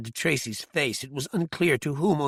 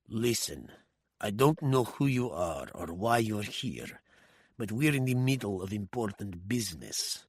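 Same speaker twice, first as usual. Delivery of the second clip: low, quiet, threatening warning; menacing thug; 4 semitones lower, 7 dB quieter than usual. A narrator's reading. Osirov warning off the intruding woman, low menace. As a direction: lean in and warn her off. Osirov's face darkened ominously.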